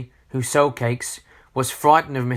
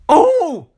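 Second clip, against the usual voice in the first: very high, loud startled "oh"; cartoonish yelp of alarm; alarmed squeak; 8 dB louder than usual. A narrator's reading. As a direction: squeak as if suddenly tickled. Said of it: "squeaked Mister Grumpy in alarm".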